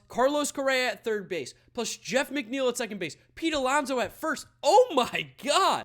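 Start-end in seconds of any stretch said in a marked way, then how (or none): 4.6-5.8 slow, drawn-out gasp of disbelief; overwhelmed by the news; stunned. Real, not acted. Fan reacting to the lineup, stunned and slow.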